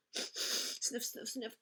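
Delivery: soft sniffs through the nose; sniffing. Pretend. Teacher reading to her class, quiet sniffing. Reading noise words aloud.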